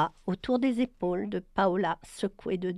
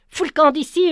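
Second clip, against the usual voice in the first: a high, much louder furious yell; 7 semitones higher, 12 dB louder, faster than usual. Acted. Tom yelling at Brian to get out, furious yell. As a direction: throw the intruder out with a shout. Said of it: "hurla-t-il".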